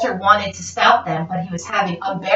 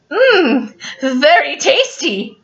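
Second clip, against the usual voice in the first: mock dog savoring; 5 semitones higher, slower than usual. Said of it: "said Walter to himself".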